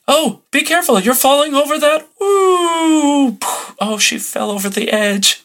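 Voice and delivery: high, hammed-up gasping alarm; radio drama actress. Mock radio actress crying out as someone falls. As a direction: overplay the worry like old radio. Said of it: "You know, like".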